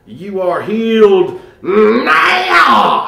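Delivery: high, drawn-out healing scream; screaming preacher. Retold or imitated. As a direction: scream it out like a revival pastor. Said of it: "he would scream out".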